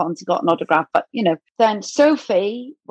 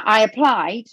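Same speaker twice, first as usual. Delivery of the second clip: usual speaking voice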